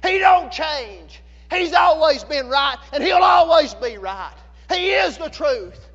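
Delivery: full-force preaching. Real, not acted. Preacher assures congregation God never changes, in emphatic full-force preaching. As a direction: drive each declaration home loudly.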